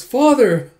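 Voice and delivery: loud, high call; acted cry for help. Frightened girl calls for her father, crying out. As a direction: cry out as if desperate for rescue.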